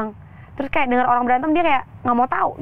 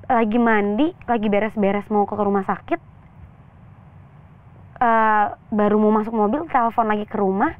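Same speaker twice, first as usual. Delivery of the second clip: usual speaking voice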